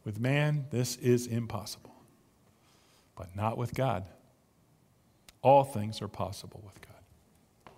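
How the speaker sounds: Jesus answering his amazed disciples, quiet and solemn. Retold or imitated. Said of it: "Jesus looked at them and said".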